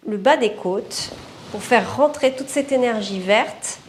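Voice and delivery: ordinary delivery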